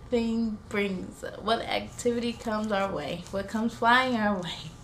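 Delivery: plain reading voice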